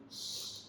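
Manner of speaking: one very soft voiceless hiss; monkey shushing noise. A narrator's reading. Monkeys copying the angry peddler, soft mocking shush. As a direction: hiss back softly like the monkeys. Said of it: "This is the noise that the monkeys are making".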